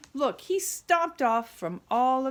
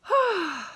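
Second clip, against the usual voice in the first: sigh; 7 semitones higher than usual. Acted. Grumpy Jim ends story.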